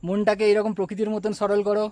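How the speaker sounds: usual speaking voice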